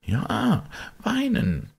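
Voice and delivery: high, hushed servant's answer; servant confiding. A narrator's reading. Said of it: "sagte der Diener". (hohe Stimme des Dieners)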